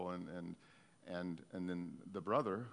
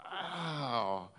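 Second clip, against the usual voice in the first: choked-up wow; 4 semitones higher, 4 dB louder than usual. Real, not acted. Preacher overcome with emotion.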